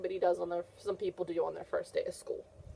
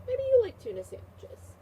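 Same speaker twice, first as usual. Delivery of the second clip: much higher, louder playful question; playful teasing; 4 dB louder than usual. Real, not acted. Teacher teasing the child.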